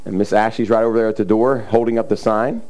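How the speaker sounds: ordinary talk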